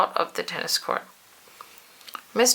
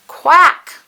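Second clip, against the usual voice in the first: high loud quack; duck quack; 10 semitones higher, 11 dB louder than usual. A narrator's reading. Narrator imitating the ducks, loud high quack. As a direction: honk it out like a startled duck.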